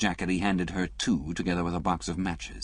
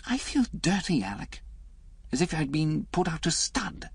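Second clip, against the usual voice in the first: lightly voiced woman, higher; a hint of a wounded young woman, higher; Liz's voice; 10 semitones higher than usual. Reader voicing Liz to Alec, lightly higher.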